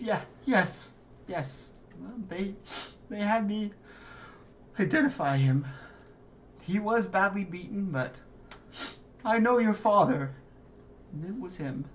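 a hint of tearful mother; a mother choking out the truth through tears. Mother confirms she identified the father's body, lightly tearful. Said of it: "bursts into tears again".

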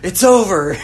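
high, loud mock exclamation of release; relieved cry. Character who finally stopped beating himself, relieved cry. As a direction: act out a character's big relief, half laughing.

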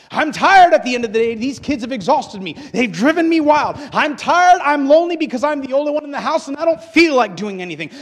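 loud, high wail of exhaustion, acted from the pulpit; overwrought complaint. Preacher voicing an exhausted, lonely parent, overwrought complaint. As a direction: cry it out like someone at the end of their rope.

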